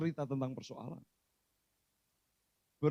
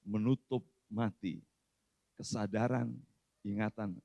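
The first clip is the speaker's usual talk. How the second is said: normal talking voice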